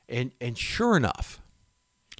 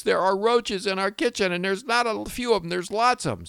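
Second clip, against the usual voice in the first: much higher voice, alarmed wife imitation; imitating his wife; 9 semitones higher than usual. Husband imitates his wife reporting roaches, high alarmed voice. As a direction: play his wife panicking about the bugs. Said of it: "She goes".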